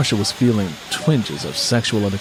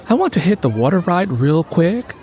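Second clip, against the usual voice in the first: higher female voice; Stacy; 7 semitones higher than usual. A narrator's reading. Stacy eager to ride, higher voice. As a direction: voice her a notch higher, eager. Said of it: "said Stacy".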